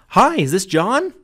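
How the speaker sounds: excited sales greeting, up high. Shown as a demonstration. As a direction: greet him like you can't wait to talk. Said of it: "start my calls off with a higher, more excited tone".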